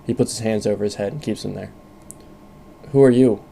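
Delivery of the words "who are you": robotic voice through a helmet speaker; mechanical helmet voice. Acted. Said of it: "voice mechanical through your helmet speaker".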